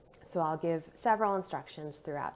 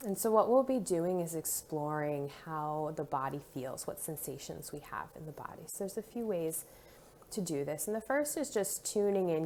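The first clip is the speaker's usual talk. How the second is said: ordinary delivery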